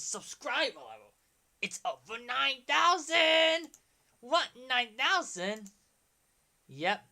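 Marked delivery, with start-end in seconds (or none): 1.6-3.7 slow, high, loud over-nine-thousand yell; drawn-out shouted reveal; Vegeta meme shout. Acted. 4.2-5.7 mock shock. Anime meme reply.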